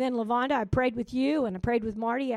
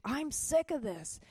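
higher, drawn-out fed-up whine; fed-up complaint; 6 semitones higher than usual. Retold or imitated. Telling the church she was tired of crying, fed-up. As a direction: complain wearily, stretching the words.

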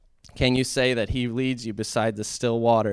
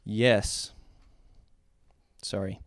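ordinary talk, nothing marked

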